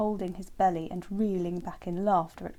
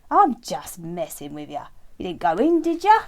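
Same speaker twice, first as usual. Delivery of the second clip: cheeky frog; 11 dB louder than usual. Acted. Frog answering Terence's glare, loud cheeky frog voice. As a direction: brush off his look cheekily.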